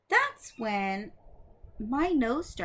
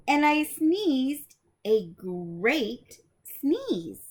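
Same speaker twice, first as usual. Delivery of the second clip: slow and louder, big storyteller emphasis; dramatic storytelling; 4 semitones higher, 6 dB louder, much slower than usual. Acted. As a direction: drag it out dramatically. Telling the huge sneeze.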